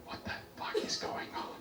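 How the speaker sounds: hushed voiceless aside; very soft, aghast whisper; stunned whisper. Acted. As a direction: whisper it in disbelief. Old people murmuring in shock.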